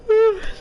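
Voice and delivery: sharp high loud gasp; startled gasp. Real, not acted. Streamer startled by an attack, sharp gasp. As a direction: catch your breath in sudden fright. Sudden startle during play.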